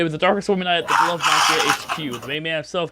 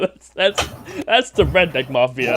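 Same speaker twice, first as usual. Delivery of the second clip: ordinary talk, nothing marked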